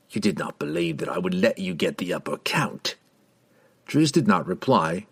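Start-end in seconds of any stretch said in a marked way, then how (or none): 0.1-2.9 low menacing voice. A narrator's reading. Assassin taunts the drow.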